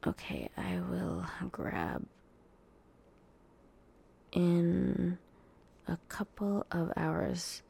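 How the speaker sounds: soft whispered aside; hushed off-camera whisper. Real, not acted. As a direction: whisper off mic to someone nearby. Talking to someone off camera.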